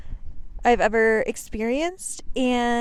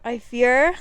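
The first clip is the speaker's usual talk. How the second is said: shouting over wind; 3 semitones higher, 7 dB louder than usual. Real, not acted. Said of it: "hear me over the wind".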